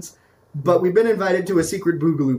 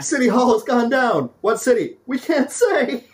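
dramatic news reporter; 8 semitones higher than usual. Pretend. Mock breaking news report.

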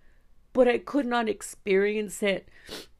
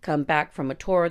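normal talking voice

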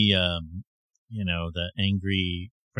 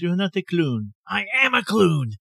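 clown character quote; 9 semitones higher than usual. Retold or imitated. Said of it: "She was not the clown".

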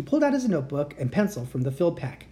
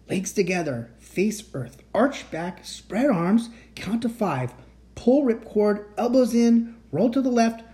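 plain reading voice

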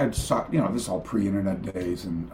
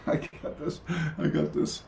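normal talking voice

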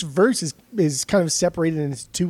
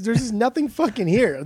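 higher-pitched, heated protest; exasperated objection; 7 semitones higher than usual. Real, not acted. Co-host disputing praise of the cover, exasperated. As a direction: push the pitch up in frustration.

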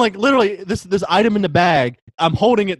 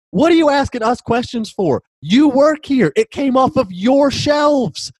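higher, louder exasperated demand; much higher, fed up and indignant; fed-up retort; 11 semitones higher, 4 dB louder, slower than usual. Retold or imitated. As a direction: throw it back at the clueless clerk. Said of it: "I got fed up with it".

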